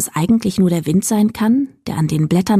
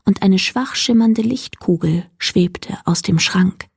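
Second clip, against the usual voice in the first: ordinary delivery, nothing marked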